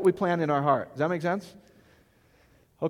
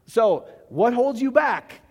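ordinary delivery

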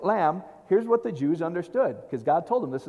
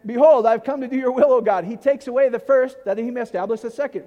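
scripture proclaimed loud; 5 semitones higher, 8 dB louder than usual. Acted. Reads scripture at full force.

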